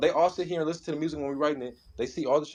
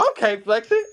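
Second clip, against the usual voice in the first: high, louder hype reaction; retold hype approval; 8 semitones higher, 5 dB louder than usual. Cousin approving the rapper's verse, high excited mock reaction. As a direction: imitate an impressed cousin cheering on a bar. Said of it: "He was like".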